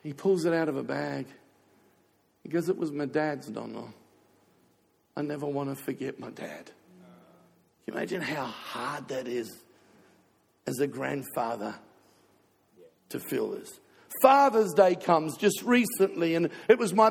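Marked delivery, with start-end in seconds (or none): 2.7-6.7 retold grieving grandson. Retold words of bereaved grandson. 6.7-13.8 low, soft, pained aside; heavy-hearted, dropping low and hushed; real grief. Real, not acted. Grieving grandfather confides his pain.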